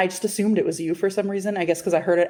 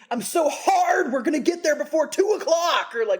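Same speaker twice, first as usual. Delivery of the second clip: passionate outburst; 8 semitones higher than usual. Retold or imitated. Macy exploding on the highway, hammed-up passion. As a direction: blurt it out with mock passion. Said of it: "with passion exploded and said".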